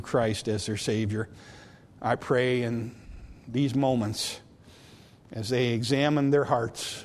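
soft, low, earnest prayer voice; closing prayer. Acted. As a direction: pray quietly over the congregation.

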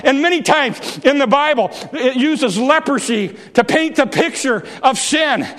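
shouting sermon climax; full-force preaching. Acted. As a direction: preach it out to the back row.

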